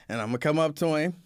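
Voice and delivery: ordinary delivery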